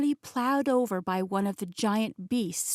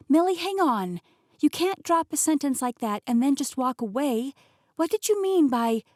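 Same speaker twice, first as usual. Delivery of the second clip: higher, insistent voice building in urgency; pitched-up protest, cut off mid-question; indignant protest; 5 semitones higher, 4 dB louder than usual. Acted. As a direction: demand answers from someone walking off. Protests as aunt walks away.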